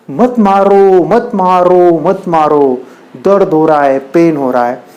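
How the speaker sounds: urgent, repeated plea from a hurting stone; pleading stone. Acted. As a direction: beg them to stop hitting you. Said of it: "उस पत्थर से एक आवाज़ आती है".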